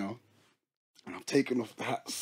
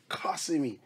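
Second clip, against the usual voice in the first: ordinary talk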